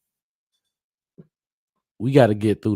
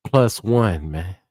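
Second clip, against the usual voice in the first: usual speaking voice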